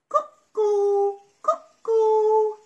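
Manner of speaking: high loud cuckoo; cuckoo call. Acted.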